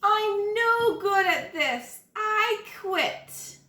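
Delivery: sulky, defeated "I quit"; a kid throwing in the towel; frustrated girl quitting. Acted.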